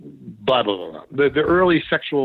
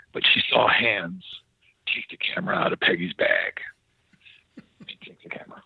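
hushed reveal; 7 semitones lower, 5 dB quieter than usual. Retold or imitated. Retelling Gary revealing the psychic's vision of hands, hushed.

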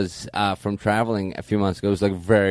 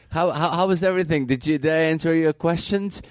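needy service rep; 7 semitones higher than usual. Acted. Plays needy service rep on phone.

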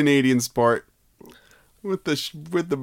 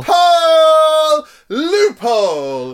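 much higher, louder, slow stretched yell; drawn-out loophole cry; 11 semitones higher, 6 dB louder, slower than usual. Pretend. Friend crows the loophole back at his cohost, slow and loud. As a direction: hold the word out like a victory call.

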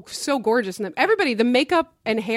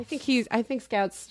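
ordinary talk

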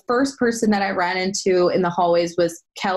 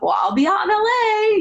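bright, high excited answer; high, eager retold reply; 12 semitones higher than usual. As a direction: toss it off eagerly, pitched way up. Excited reply to producer offer.

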